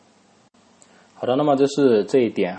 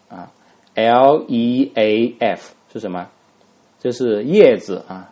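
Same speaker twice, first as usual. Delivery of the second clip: usual speaking voice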